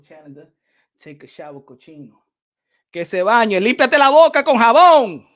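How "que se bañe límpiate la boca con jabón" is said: shouted insult. Acted.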